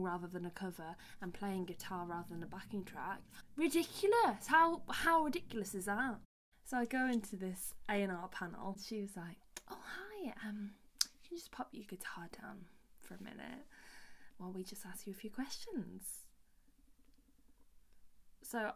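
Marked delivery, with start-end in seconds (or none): 3.6-6.2 loud, high-pitched exclamation of disbelief; indignant. Real, not acted. Singer venting about being told to do covers, indignant. 9.7-16.3 patronising A&R woman. Retold or imitated. A&R woman asking singer to put guitar down, mock-sweet.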